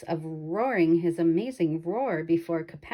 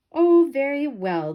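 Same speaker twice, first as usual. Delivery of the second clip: bored lion, higher and louder; lion stretching into a lazy yawn; 8 semitones higher, 8 dB louder than usual. Acted. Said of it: "the lion yawned".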